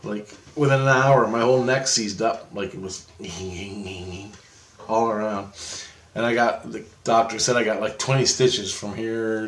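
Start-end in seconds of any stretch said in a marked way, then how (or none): 3.2-4.3 low, soft strained creaking noise; imitated seizing noise. Storyteller showing his neck seizing up, soft creaking imitation. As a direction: quietly mimic something locking up.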